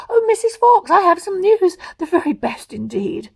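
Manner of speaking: high, loud excited fox; excited Mister Fox. Acted. Mister Fox bringing news home to his wife, excited. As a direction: burst in with big news.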